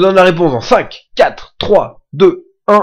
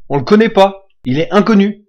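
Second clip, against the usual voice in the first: ordinary delivery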